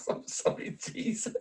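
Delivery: breathless whisper through laughter; whispered laughing repeat. Retold or imitated. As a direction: echo the line while cracking up. Listener repeats quote, laughing.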